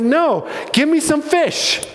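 high, drawn-out mock protest; playful retold insistence, stretched out; acted exasperated reply. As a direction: wave off their fear, pitched well up. Jesus answers frightened disciples.

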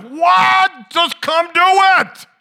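high, very loud exasperated yell; frustrated parent's high-pitched shouted outburst; losing it. Retold or imitated. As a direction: blow up at the kids in full-volume outrage. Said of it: "I just sort of lose my mind".

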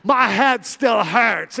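mock hungover college-girl voice; annoying college girls. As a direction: drag it out like a whiny party girl. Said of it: "they're just talking like this".

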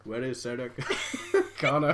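lightly voiced Terminator; a hint of a monotone machine; robot voice. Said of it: "[name]'s a robot".

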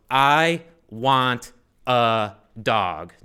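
drawn out word by word, loud; slow, emphatic reading. Retold or imitated. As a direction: spell out the note one weighty word at a time. Reading out his written four-word note.

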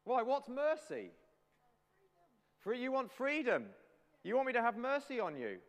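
high, loud royal astonishment; mock-incredulous king. Preacher as King Henry answering a prisoner's plea, high incredulous voice. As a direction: play an affronted king, pitched right up.

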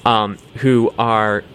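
normal talking voice

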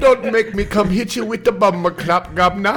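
a hammy Cockney Jamaican threat; a loud English-Jamaican accent; English-Jamaican mix. Acted. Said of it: "sounded like an English Jamaican dude".

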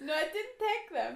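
loud, high protest; protesting denial. Real, not acted. Storyteller denying to friends she stole them, protesting. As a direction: deny it emphatically.

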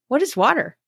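innocent, wondering question; puzzled fish. Retold or imitated. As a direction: pitch it up like a fish who has never heard of water. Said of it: "I'll say that again".

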